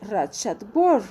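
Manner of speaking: shatwur repeated slowly with the wur ending. Shown as a demonstration. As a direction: echo shatwur, rounding the ur. (la maestra repite shatwur lento, marcando la ur)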